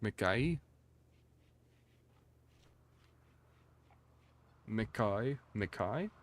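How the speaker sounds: "Mikai" said mi-KAI, testing it as a question; trying out Mikai. Shown as a demonstration. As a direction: sound out "Mikai" as mi-KAI with a rising tone. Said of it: "pronounced Mikai instead of McKay".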